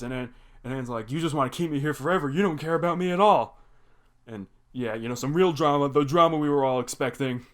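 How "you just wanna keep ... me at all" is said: fast, rising to a loud hurt yell; mock teen tantrum, speeding up and getting louder; angry outburst. Anne yelling at Hop Pop.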